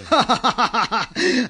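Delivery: rising, delighted chuckle; a greedy little laugh of glee; gleeful chuckle. Acted. Emperor hearing of the tax haul, gleeful chuckle.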